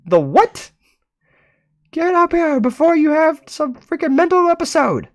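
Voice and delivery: very high, loud, shrieking parent voice; screaming parent upstairs. Acted. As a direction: yell down like an exasperated mother. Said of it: "The voice screams back".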